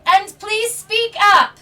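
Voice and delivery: deputy cupping his ear, asking them to speak up loudly; hard-of-hearing shout. Acted. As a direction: shout it like a half-deaf deputy. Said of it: "turns his right ear to you and says".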